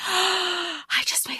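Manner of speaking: startled discovery; gasp of realization. Real, not acted. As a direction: realize it mid-sentence with a gasp. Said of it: "I didn't even make that connection".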